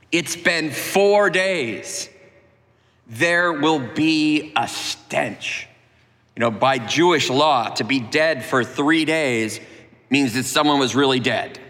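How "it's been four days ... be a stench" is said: drawn-out, very slow warning. Acted. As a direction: stretch each word out slowly. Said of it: "They're like".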